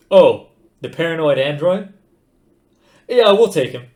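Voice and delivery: loud, high, swaggering and careless; loud breezy bravado; brash Zaphod voice. A narrator's reading. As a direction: toss it off like a cocky space captain.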